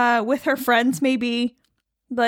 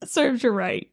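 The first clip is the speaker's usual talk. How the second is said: New York accent slip. Real, not acted. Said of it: "Sometimes the accent comes out".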